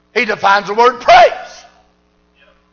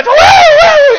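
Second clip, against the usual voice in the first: put-on very high, loud girlish whoops; little girl's whoops; 8 dB louder than usual. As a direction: imitate a girl shouting for joy. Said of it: "He said".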